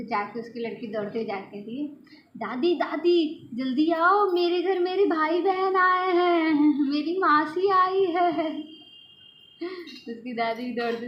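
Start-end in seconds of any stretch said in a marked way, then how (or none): 2.4-8.6 much higher, louder, slow eager calling; little girl calling grandma that her cousins came; excited child calling. Retold or imitated. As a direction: shout the news like a thrilled little girl. Child running to call grandma.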